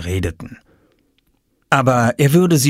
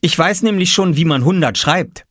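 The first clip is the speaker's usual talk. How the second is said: put-on higher boyish child voice; Oskar's voice; 8 semitones higher, 3 dB louder than usual. A narrator's reading. Narrator voicing young Oskar, proud. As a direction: play the little boy, pleased with himself.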